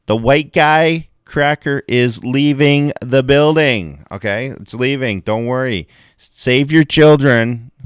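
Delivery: slow, high sarcastic proclamation; mock announcement. Leaving the bar, announcing himself sarcastically, slow and high. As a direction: announce your exit with heavy sarcasm.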